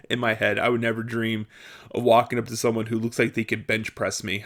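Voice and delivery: usual speaking voice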